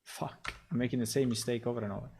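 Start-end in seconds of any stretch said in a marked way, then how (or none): 0.0-0.5 breathless whispered swear under the breath; whispered curse. Real, not acted. Streamer cursing the broken search, whispered. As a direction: curse quietly at the screen.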